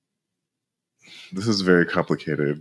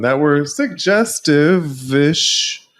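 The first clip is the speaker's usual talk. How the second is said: higher, louder, slow and knowing; suggestive drawl; 8 semitones higher, 5 dB louder, much slower than usual. Real, not acted. Co-host hinting at leaked texts, slow and knowing. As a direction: stretch it out with a knowing wink.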